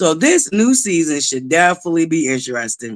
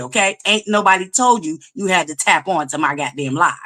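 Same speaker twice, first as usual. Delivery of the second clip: ordinary delivery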